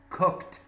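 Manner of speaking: "Cooked" short, ending in a T, cookt. Shown as a demonstration. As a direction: say "Cooked" with a voiceless final T. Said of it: "Pronunciation of D and T are extremely similar".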